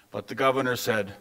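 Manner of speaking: plain reading voice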